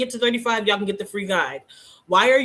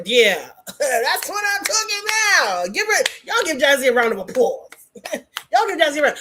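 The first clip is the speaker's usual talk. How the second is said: elated cheering; 5 semitones higher, 3 dB louder than usual. Real, not acted. Host celebrating Jazzy's confident answer, elated and laughing. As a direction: cheer them on, stretched and laughing.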